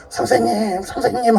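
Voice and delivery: high, pleading call of a weeping woman; weeping women calling out. Retold or imitated. Women on the road crying after their teacher, pleading high call. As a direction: cry out his name, begging.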